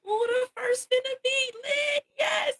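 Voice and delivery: high squeaky excited voice; playful high-pitched hype; mock hyped self. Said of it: "I was like".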